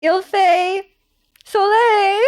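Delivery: high, halting beginner French; high-pitched French phrasebook line; beginner's French. Shown as a demonstration. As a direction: recite schoolroom French, breaking into giggles. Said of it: "I can literally say like that".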